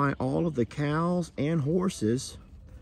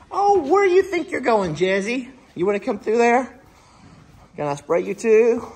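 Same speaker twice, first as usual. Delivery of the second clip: high, singsong talk to an animal; pet talk; 11 semitones higher, 3 dB louder than usual. Real, not acted. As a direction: coax the animal in a much higher voice. Coaxing an animal to be sprayed.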